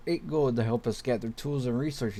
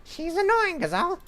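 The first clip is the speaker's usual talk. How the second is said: very high-pitched girl voice, put on by a man; squeaky daughter voice. Daughter brushing off her father, very high squeaky voice. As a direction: squeak it out like a sulky young daughter. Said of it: "Doing female voices is not my forte".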